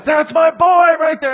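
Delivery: very high and loud, screaming it out; proud dad scream. Retold or imitated. Father screaming from the bleachers at his son. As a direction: shriek it like a dad gone wild. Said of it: "and screaming".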